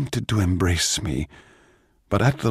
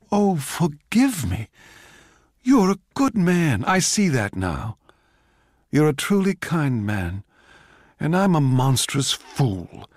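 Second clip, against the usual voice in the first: higher, gushing gratitude; overwhelmed; 7 semitones higher than usual. Acted. Overwhelmed Mozart thanks Salieri with gushing remorse. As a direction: pour out grateful remorse. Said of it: "Mozart was overwhelmed".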